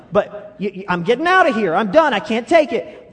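loud exasperated exit; storming off yelling. Retold or imitated. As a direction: shout it like you're slamming the door. Acting someone leaving an argument.

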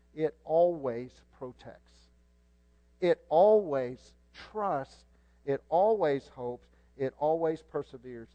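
slow, loud "It always trusts"; stress on always. Shown as a demonstration. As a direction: underline "always" every time. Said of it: "underline the word always".

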